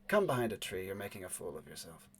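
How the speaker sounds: soft, smooth, unhurried lady musing aloud; a poised woman, quietly amused; smooth woman's voice. Acted. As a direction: let it glide, cool and quiet. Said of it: "the smooth voice of the woman".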